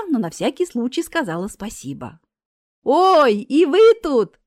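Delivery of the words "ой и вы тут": loud, high, delighted surprise; thrilled to bump into someone; joyful greeting. A narrator's reading. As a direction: call out happily, drawing it out. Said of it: "послышался чей-то радостный голос".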